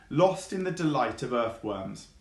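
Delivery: usual speaking voice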